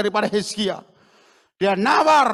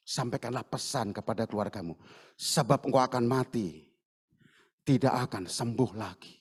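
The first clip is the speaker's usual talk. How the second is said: grim solemn reading; 10 semitones lower, 8 dB quieter than usual. Acted. Rereading the grim message softly.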